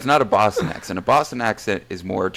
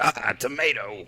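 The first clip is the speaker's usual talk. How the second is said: put-on drawn-out Jersey accent; New Jersey accent; 3 semitones higher, slower than usual. Finding tomato on the food, slow Jersey accent. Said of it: "you got a little New Jersey still left in you".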